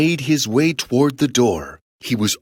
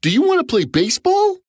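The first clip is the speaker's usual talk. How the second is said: high, quick playful invitation; eager giant kid; faster than usual. Acted. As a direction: ask brightly as a kid wanting a playmate. Giant invites Jack to play.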